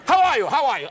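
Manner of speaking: blaring, high-pitched mock greeting; megaphone-loud mother. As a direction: holler it like an overbearing mom. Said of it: "like she had a megaphone".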